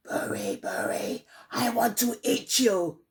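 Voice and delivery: whispered name, then a mild snarl; snarling fox. Acted. Fox threatening old Buri with a mild snarl. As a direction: sneak up on her, then threaten. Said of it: "he snarled".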